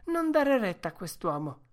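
high, loud, firm woman's voice; resolute Mercedes. A narrator's reading. Woman rushing to defend her brother, high resolute command. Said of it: "disse risoluta".

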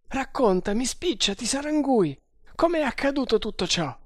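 high, agitated demand; impatient urging. A narrator's reading. Guard urges the captive to tell all, impatient. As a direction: hurry the story out of them.

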